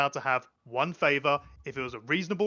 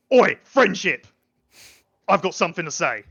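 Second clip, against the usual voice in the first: shouted across the crowd, loud; loud call out; 9 dB louder than usual. Acted. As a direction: call her out loudly over the crowd noise. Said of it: "takes a few steps and goes".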